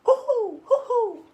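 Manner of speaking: high, loud owl hooting; owl hoots. Acted. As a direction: hoot like an owl in the woods.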